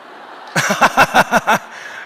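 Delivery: high breathless laugh, falling; hearty laugh. Retold or imitated. Preacher laughing at his own joke about Jesus, high breathless laugh. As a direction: let out a big laugh mid-joke.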